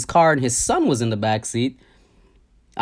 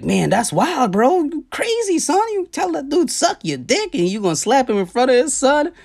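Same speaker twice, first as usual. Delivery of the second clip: much higher, animated voice for the friend; excited friend; 13 semitones higher than usual. Retold or imitated. The friend in the car marvels at the speaker, excited and much higher. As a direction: play the stunned friend, amazed.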